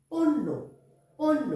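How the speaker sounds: পণ্য said as "ponno", with a doubled n and no y sound; clear pronunciation demo. Shown as a demonstration. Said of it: "মূর্ধন্য নয় জফলা".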